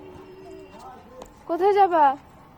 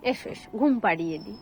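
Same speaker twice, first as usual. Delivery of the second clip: lulling softly; 7 semitones lower, 4 dB quieter than usual. Real, not acted. Mother lulling child to sleep.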